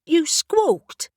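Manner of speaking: high, sharp and indignant; annoyed accusation. Acted. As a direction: catch the bird out, miffed. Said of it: "she became annoyed".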